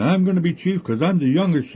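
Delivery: lightly voiced boastful frog; cocky young frog. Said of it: "One is saying".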